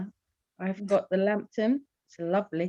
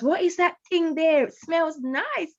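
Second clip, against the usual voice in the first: put-on Caribbean accent; 7 semitones higher than usual. Wife quotes her husband on the house's smell, imitated Caribbean accent. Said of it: "in his Caribbean, um, accent".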